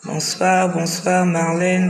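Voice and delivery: normal talking voice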